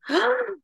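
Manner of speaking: imitated gasp of shock, high; sharp, high-pitched retold gasp. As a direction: gasp like a stunned mom. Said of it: "I remember my mom going".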